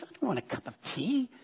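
a light mock grumble of refusal; introverted inner voice. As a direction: voice the shy self who wants to decline. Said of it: "My introverted self immediately thinks".

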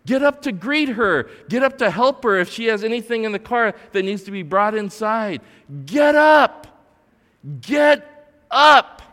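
high, full-force shouted command; screaming. Acted. Imagined voice yelling at a husband on the couch, screaming. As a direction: scream it like an urgent order. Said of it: "someone's screaming at me".

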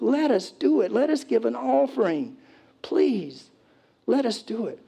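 high, whiny-insistent pleading; hammed-up pleading; begging. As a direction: beg for permission, over and over. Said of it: "They were begging Paul".

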